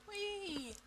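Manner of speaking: gleeful high whee. Real, not acted. Coach squealing with delight at her clearing. Playful exclamation.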